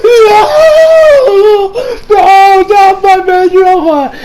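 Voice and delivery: high, long-drawn wail of horror; anguished mock scream. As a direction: scream it out in hammed-up grief. Acting own horror at Rohan exploding.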